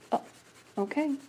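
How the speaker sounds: ordinary delivery, nothing marked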